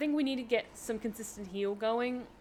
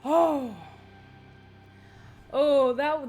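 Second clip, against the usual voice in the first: startled; 5 semitones higher, 11 dB louder, much slower than usual. Real, not acted. Narrowly surviving a hit.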